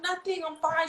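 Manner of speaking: high, softened mock-brave insistence; tearful denial. Tearful worker reassuring coworker, high imitated denial. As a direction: imitate brushing off concern while crying. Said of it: "You're crying".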